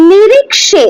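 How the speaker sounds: নিরিক্সে said with "kshe", not "khe"; teacher models নিরিক্সে with a ksh cluster; Sanskrit ksha sound. Shown as a demonstration. Teacher shows students the Sanskrit ক্ষ in নিরিক্সে. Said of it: "খ হয় না".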